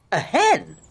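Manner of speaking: high, doubtful mother; surprised. Acted. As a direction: scoff at the hen. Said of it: "said his mother".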